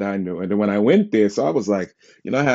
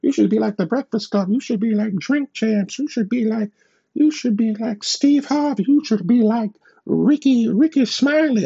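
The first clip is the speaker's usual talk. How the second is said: mocking advice-givers; 13 semitones higher than usual. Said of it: "And it's like".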